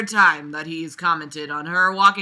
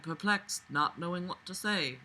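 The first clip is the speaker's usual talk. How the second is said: ordinary talk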